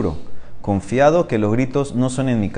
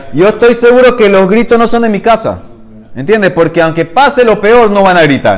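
loud, high, fervent conviction; ringing, insistent declaration; emphatic certainty; 8 semitones higher, 7 dB louder than usual. Acted. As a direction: proclaim it with total confidence. Teacher dramatizes confident man's certainty.

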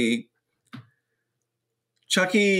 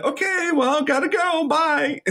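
much higher, chirpy sign-off; cheerful hammed-up hang-up; breezy mock goodbye; 12 semitones higher than usual. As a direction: rush off the phone up high. Tiffany hanging up phone.

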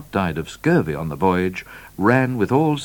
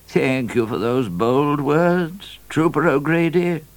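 grateful governor; 8 semitones higher, slower than usual. A narrator's reading. Governor heartened by trooper's bold words.

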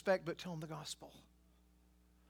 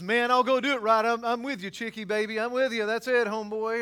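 flippant mock Isaiah; 4 semitones higher, 7 dB louder than usual. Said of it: "or whatever".

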